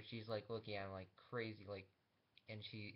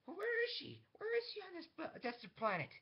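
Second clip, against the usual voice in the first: very high, loud frantic shouting; frantic demand; 8 dB louder than usual. Acted. Yeldan demands where the rebel is, frantic shouted demand. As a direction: shriek the questions urgently.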